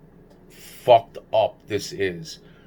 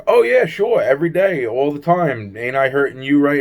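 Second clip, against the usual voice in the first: much higher, louder mock-cheerful yes; sarcastic joke reply, pitched up high; joking sarcasm; 6 semitones higher, 5 dB louder than usual. Narrator jokes back at Alfredo, high and loud sarcasm. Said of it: "I was joking around with him".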